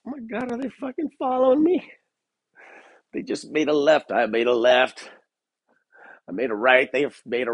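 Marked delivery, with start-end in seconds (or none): 0.1-1.9 high, startled burst of paranoia; mock paranoid panic. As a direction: freak out like you're sure a car is tailing you. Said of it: "And I'm like".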